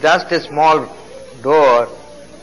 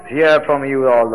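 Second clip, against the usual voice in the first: ordinary talk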